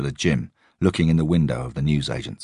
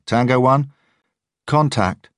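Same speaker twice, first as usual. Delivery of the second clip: radio report; 10 semitones higher, 6 dB louder, slower than usual. A narrator's reading. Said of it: "said a woman's voice".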